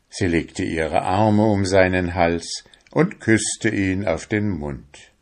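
usual speaking voice

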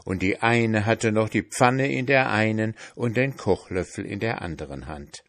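ordinary delivery

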